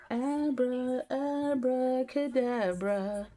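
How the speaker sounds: playful spell incantation; mock magician. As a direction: cast it like a stage magician. Magic wand joke.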